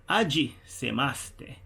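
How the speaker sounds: "Ajisemaste" drawn out slowly; a bit slow. Shown as a demonstration. Teacher repeating a Japanese greeting for learners, a bit slow. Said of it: "Let me say it a bit slow".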